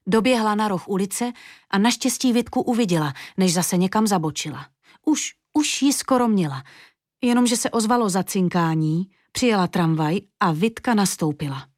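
usual speaking voice

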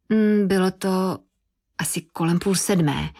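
slow, drawn-out hum before answering; stall, feeling for a plausible time; hesitant stalling. A narrator's reading. Rachel lying to the detective about the time, hesitant.

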